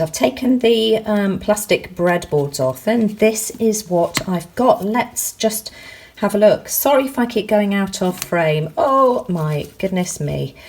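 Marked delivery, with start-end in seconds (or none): none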